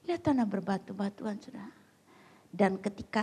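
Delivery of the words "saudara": ordinary talk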